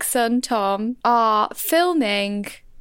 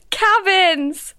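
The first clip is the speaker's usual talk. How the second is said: rally the crowd; hyped crowd warm up; 7 semitones higher, 4 dB louder than usual. Pretend. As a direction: hype man call. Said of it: "When I say cabins".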